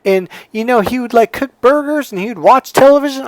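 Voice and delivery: high-pitched Southern woman griping; Southern accent. Retold or imitated. Older woman listing her late husband's habits, complaining Southern accent. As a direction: mimic a Southern lady's whiny list of grievances. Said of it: "She had a southern accent".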